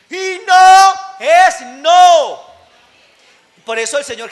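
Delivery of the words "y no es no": no punched high and loud; louder, higher declaration of no; emphatic shout. Real, not acted. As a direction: shout it out as a firm rule. Preacher stressing no means no.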